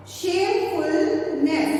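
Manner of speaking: shamefulness said very slowly; slow syllable by syllable. Shown as a demonstration. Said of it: "When we pronounce this word".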